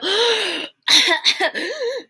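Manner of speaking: high, sharp gasp for air; mouse gasp. Acted. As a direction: gasp like you just surfaced. Mouse gasping from the oil.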